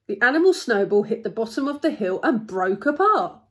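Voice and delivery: lively storybook narrator; story narration. A narrator's reading. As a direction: tell it with punched key words. Story narration read aloud.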